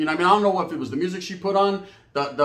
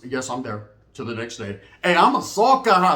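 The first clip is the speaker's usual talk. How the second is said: usual speaking voice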